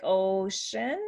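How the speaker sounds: slow "ocean" with a stretched "oh"; long O. Shown as a demonstration. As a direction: draw out the first vowel of "ocean". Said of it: "the long sound is".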